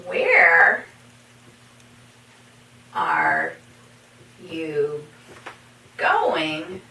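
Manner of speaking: spaced-out question rising at the end; slow word by word. Shown as a demonstration. Teacher to students, slow second example question. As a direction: ask it slowly for learners. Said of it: "For example".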